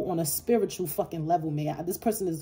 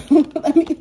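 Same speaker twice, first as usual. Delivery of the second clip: laughing; 6 semitones higher, 8 dB louder than usual. Real, not acted. Cracking up at the reading.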